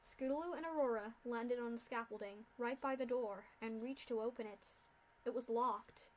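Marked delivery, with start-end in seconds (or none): none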